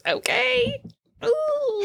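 surprised. Real, not acted. Zooming in without her reading glasses.

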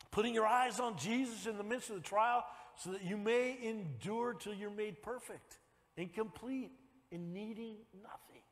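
ordinary talk

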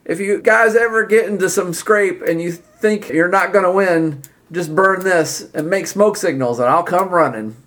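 high, emphatic promise of help; earnest Rebus. Acted.

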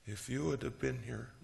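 a soft whimpering complaint; Mary reproaching Jesus in a whiny tone. Acted. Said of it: "Kind of whiny".

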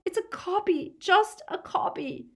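high, tearful insistence; distressed, pleading denial; sobbed plea. A narrator's reading. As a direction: beg to be believed under torture. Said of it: "Hermione sobbed".

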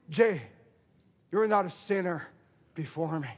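low, soft voice of a forgiving God; tender reassurance. Acted. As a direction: reassure gently, dropping almost to a hush. Voicing God forgiving a believer.

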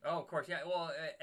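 ordinary delivery

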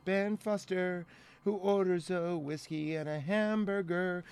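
reads his notes aloud under his breath; reading notes aloud. Real, not acted. Podcaster reading his scene notes under his breath. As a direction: mumble through the notes quickly to himself. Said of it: "let me actually look at my notes".

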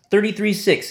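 ordinary talk

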